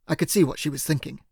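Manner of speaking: ordinary delivery, nothing marked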